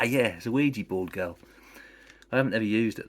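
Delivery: plain reading voice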